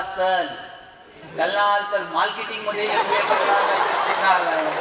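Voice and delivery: every र turned into ल, "मार्केटिंग" said as "मालकेटिंग"; imitated lisping classmate. Classmate stands up to answer the teacher back, R turned to L in "सर" and "मार्केटिंग". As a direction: mimic a boy who says L for R, "सर" as "सल". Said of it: "त्या र ला ल म्हणायचं".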